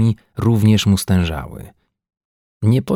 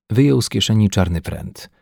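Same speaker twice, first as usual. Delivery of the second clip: ordinary delivery, nothing marked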